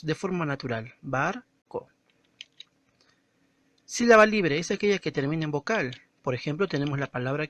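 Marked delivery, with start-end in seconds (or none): 1.0-1.9 "Barco" split as BAR, co, with co left unforced; unstressed second syllable. Shown as a demonstration. Teacher showing the unstressed syllable, "Barco" with an unforced co. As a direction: draw out BAR in "Barco", pause, then a light co. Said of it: "la segunda sílaba es la que se pronuncia sin fuerza de voz".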